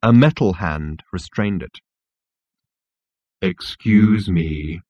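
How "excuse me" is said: depressed robot. Acted. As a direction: drag it out in gloom. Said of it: "in a voice that would have made an insect".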